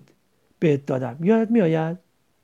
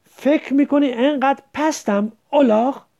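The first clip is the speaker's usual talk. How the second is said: shouted, higher and louder; angry shout; 6 semitones higher, 5 dB louder than usual. A narrator's reading. Majid shouts at Qasem, furious. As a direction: yell it after the slap. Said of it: "فریاد کشید".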